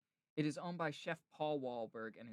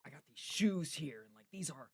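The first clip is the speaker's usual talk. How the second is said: mock super-aggressive presenting; 3 semitones higher, 3 dB louder than usual. Imitating a celebrity showing off shoes on TikTok, mock-aggressive. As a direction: imitate an over-aggressive product pitch, a bit higher. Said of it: "he'll present it, like, s-, almost super aggressively".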